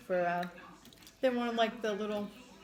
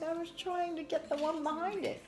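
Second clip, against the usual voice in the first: imitated little girl; 8 semitones higher than usual. Mom quoting her daughter's excuse, high imitated child voice. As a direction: put on a small kid explaining herself. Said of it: "She's like".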